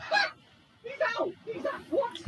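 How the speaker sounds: high, excited exclamation; astonished cry. Real, not acted. Player seeing the rival knocked out, astonished cry.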